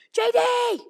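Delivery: high, loud drawn-out yell; calling out. Retold or imitated. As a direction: holler a name across the street. Lily calls out the window.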